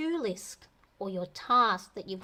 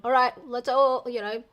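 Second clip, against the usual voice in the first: loud group call; 3 semitones higher, 8 dB louder than usual. Retold or imitated. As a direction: call everyone to bed like a leader. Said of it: "group going".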